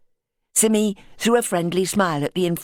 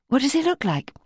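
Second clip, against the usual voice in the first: Simmy's voice; 9 semitones higher than usual. Acted. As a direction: ask it carefully, pitched up.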